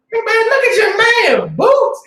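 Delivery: very high shouted taunt; squeaky mock yell at dusty boots; mocking kid. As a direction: jeer like a kid pointing at boots.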